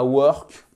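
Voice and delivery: "work" made with the tip of the mouth; front-of-mouth mispronunciation. Shown as a demonstration. Teacher showing the work to avoid, front-of-mouth French vowel. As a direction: say "work" wrongly, French-style at the lips. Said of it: "c'est pas le work avec le bout de la bouche".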